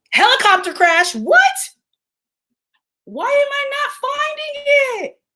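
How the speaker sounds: very high, stretched-out wail of shock; alarmed disbelief. Real, not acted. Searching for news of the helicopter crash, alarmed. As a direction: voice shooting up in distress.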